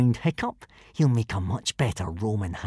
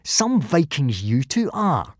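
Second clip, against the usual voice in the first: higher, scornful sing-song sneer; drawled taunt; 7 semitones higher than usual. A narrator's reading. Bully ridiculing Hiccup before the boys, drawling mockingly. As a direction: draw out the ridicule. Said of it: "drawled Snotlout".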